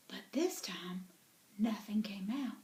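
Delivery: hushed storyteller whisper. A narrator's reading. Narrator whispering to the children. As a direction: drop to a whisper for suspense.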